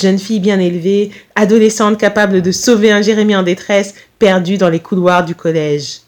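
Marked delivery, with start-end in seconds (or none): none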